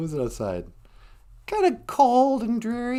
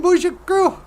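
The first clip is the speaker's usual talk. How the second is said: ordinary delivery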